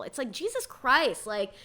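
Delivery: ordinary delivery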